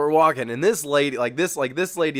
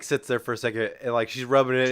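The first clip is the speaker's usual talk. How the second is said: ordinary talk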